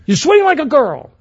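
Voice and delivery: hammed-up angry sports dad; mock shouting dad. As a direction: yell it from the stands like an obnoxious father. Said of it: "the dad would shout".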